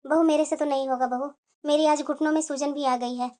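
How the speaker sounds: low, soft, ailing refusal; subdued excuse. Acted. Mother-in-law excusing herself over swollen knees, subdued and low. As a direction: beg off quietly because of sore knees.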